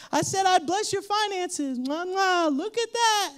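high, proud, smacking giver; mock God. Preacher voices God offering leaf blessings, high and proud. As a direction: pitch up, smug pretend feeding.

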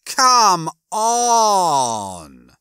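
loud "Come on!" with "on" held for seconds; long-drawn "on". Acted. Rimmer groans at Lister, "on" hugely drawn out. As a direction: stretch the O of "on" endlessly, sliding down from high. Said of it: "made the O in on last three full seconds".

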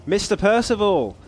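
put-on high shouted name, dropping the English accent; non-English accent attempt. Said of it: "Not in an English accent, though".

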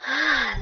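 puffed-out exhale; acted out-of-breath sigh; panting sigh. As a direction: breathe out as if winded from climbing. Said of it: "Puffing and panting".

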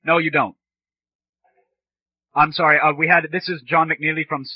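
ordinary delivery

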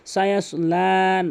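সায়াসলা said slowly with the lam stretched; elongated lam. Shown as a demonstration. Teacher showing students how to stretch the লাম, slow and drawn out. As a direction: draw out the লা in সায়াসলা. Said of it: "লাম কিন্তু এখানে টানতে হবে".

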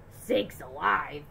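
put-on a slow astonished old-fashioned gasp; gasped exclamation. A narrator's reading. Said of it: "he gasped".

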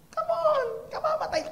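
high, exasperated disbelief; incredulous. Real, not acted. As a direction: scoff at the thoughtless comfort. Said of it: "incredulous reaction".

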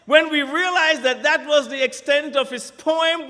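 usual speaking voice

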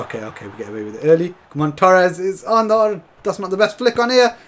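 usual speaking voice

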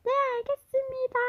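높고 빠른 아이들 대답; 건성으로 합창하듯 대답; kids answering. Acted. 학생들 대답. (학생들이 높게 대답하는 목소리)

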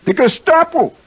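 put-on high, barked German accent; hammed-up German secret policeman; mock German accent. Host answers a knock-knock joke, hammed-up German accent. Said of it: "How'd you like my accent?".

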